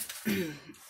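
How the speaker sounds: a soft throat-clear. Real, not acted. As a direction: clear the throat quietly mid-sentence. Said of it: "j'arrête pas de, de me racler la gorge".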